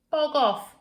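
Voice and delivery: high, loud "Bog off"; called out. Acted. Girl calling into her dark bed, loud. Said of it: "she called out".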